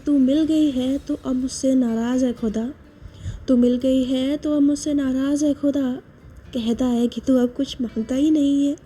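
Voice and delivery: quiet, intimate poem reading; hushed heartfelt couplet; soft shayari recital. Acted. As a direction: read it softly like a confession.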